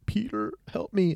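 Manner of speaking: mock plea for help, pitched high; lightly acted dying plea; dying man's plea. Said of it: "like he's fucking dying".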